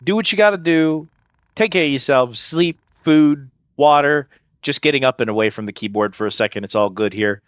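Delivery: ordinary delivery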